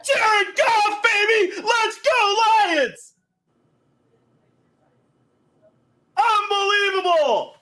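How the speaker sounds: high, loud victory screaming, real; shrieking jackpot winner. Real, not acted. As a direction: yell out pure joy at the win. Celebrating the winning touchdown.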